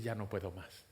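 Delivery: low, soft, resigned admission; quiet surrender. Acted. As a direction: let it sink down, spent. Same words said low, resigned.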